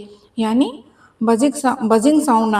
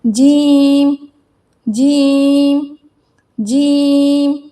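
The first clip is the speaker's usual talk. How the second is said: slow drawn out jeem; 3 dB louder, much slower than usual. Shown as a demonstration. Teacher drawing out जीम slowly for the students. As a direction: stretch जीम long and slow. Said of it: "सबसे पहले हम जीम को देखते हैं".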